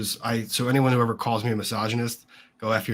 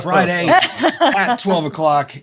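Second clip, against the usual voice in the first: announcer voice; 4 semitones higher, 6 dB louder than usual. Acted. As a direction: boom it out like a showman. Opening the show like a host.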